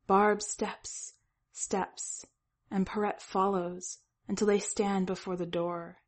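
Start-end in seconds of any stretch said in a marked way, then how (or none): none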